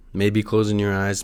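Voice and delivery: ordinary talk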